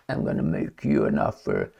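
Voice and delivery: deep, slow, husky mafia boss murmur; Godfather impression. Trump to Russia as a Godfather mob boss, low and slow. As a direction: lean in low like a mob boss making a threat. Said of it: "that's exactly how he said".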